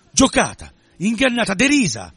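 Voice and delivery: high, loud, each word flung out; furious outburst. A narrator's reading. Ragia rages at being deceived, furious outburst. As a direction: explode with wounded rage. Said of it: "scoppio di ira tremenda".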